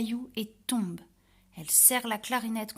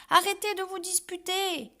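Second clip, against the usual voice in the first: put-on higher, louder little-girl plea; pleading; 10 semitones higher, 5 dB louder than usual. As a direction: beg the birds to stop. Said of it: "elle supplie". (supplication de petite fille, aiguë)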